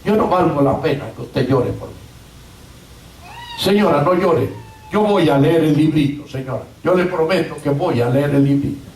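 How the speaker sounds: low, subdued plea of a moved prisoner; moved, pleading. Retold or imitated. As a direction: drop the voice low and promise earnestly. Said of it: "Fue conmovido este joven".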